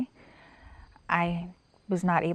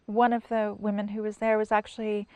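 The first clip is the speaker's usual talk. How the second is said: ordinary delivery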